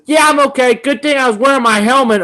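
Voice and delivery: put-on high, loud kid reply. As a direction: play a brave little kid. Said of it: "I wanna make sure I get his correct words".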